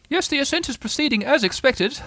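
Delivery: high, pompous owl voice; helpful Owl. Acted. Owl commenting on the ascent, high and pompous. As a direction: report like a self-important old bird. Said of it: "said Al helpfully".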